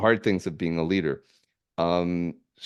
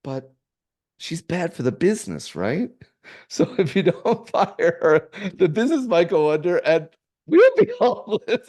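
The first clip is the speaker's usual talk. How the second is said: put-on higher, laughing through a little girl's worried logic; a laughing, hammed-up little daughter; fondly imitating his small daughter's business sense, laughing; laughing child voice; 8 semitones higher, 5 dB louder than usual. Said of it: "she said".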